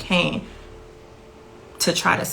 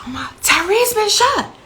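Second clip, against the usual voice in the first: high, panicked cry of bad news; high frantic cry; retold frantic scream; 13 semitones higher than usual. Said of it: "It was screaming and hollering".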